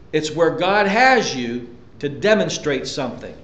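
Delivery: ordinary talk, nothing marked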